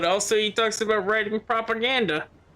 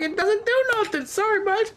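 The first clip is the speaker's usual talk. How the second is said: much higher, squeaky mock voice; mock squeaky reply. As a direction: squeak it out like a dismissive cartoon. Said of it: "they're like".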